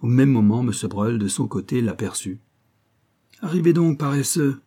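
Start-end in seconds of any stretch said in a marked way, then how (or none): 3.4-4.6 higher, mildly raised voice; calling out. A narrator's reading. Gentleman hailing the late young painter, calling out. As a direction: hail a late friend in mock reproach. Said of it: "lui cria-t-il".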